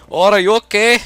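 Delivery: high, mock Indian accent, quoting an old man; playful imitation of an old man's Indian accent. Old man checking on a fallen skater, mock Indian accent. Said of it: "why I did it with an Indian accent".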